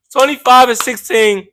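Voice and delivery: laughing through the numbers. Real, not acted. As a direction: breaks into a laugh mid-stat. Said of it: "Mm, never mind".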